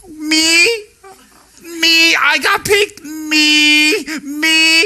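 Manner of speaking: high, loud squealing disbelief; overjoyed. Retold or imitated. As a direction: squeal like a beauty contest winner. Appointed member overjoyed.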